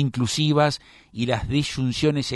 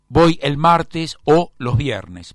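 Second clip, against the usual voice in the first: slow, louder, leaning hard on "o"; slow it down and hit "o" hard, louder; stress on the O; 5 dB louder, slower than usual. Shown as a demonstration. Teacher showing the class the exclusive "o", slow and stressed. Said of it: "lleva acento en la O".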